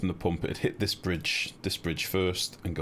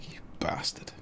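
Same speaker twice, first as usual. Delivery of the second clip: breathy whispered mock insult; whispered pretend betrayal aimed at a viewer; 5 dB quieter than usual. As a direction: hiss it as if betrayed, jokingly.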